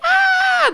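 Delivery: loud, very high-pitched scream; mock crying wail. Little weed families being sprayed, high crying scream. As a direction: wail like tiny creatures in agony. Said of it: "just crying".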